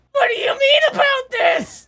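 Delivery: very high, loud, shrieking anime hero; shrill anime voice. Retold or imitated. Mocking a dub voice actor for friends, shrill shriek. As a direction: squeal it in a crying anime scream. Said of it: "is like the most shrill voice".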